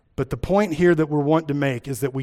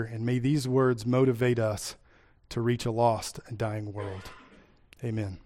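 soft, reverent prayer voice; 2 semitones lower, 5 dB quieter than usual. Acted. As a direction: pray quietly and humbly. Pastor leads a prayer.

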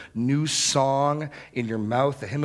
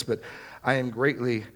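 normal talking voice

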